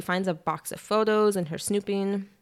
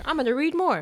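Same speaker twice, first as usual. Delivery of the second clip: high-pitched mock determination; mock resolve; 9 semitones higher, 4 dB louder than usual. Host imitating the heroine pressing on with the manuscript, mock resolve. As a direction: chirp it up high, imitating her. Acting out character's foolish resolve.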